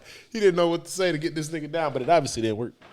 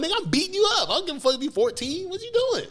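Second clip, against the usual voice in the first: mock confrontation; 11 semitones higher than usual. Mock confronting a moshing kid.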